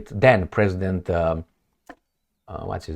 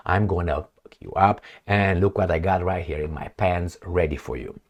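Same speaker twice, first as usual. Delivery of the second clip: low, quieter menacing growl of a strange man; 5 semitones lower, 6 dB quieter than usual. Retold or imitated. A strange man menacing a woman, low and quiet. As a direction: lean in low and quietly threatening.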